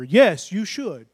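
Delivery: slow firm: Yes, you should; insist, each word weighted; slow, certain insistence; slow insistent answer. Real, not acted. Emphatic answer to doubter.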